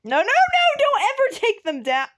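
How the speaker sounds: high, loud pleading outcry; urgent, high-pitched objection; loud protest. Real, not acted. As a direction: cry out to stop them. Protests signs being taken down.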